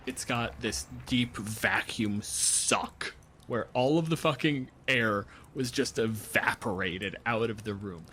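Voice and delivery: normal talking voice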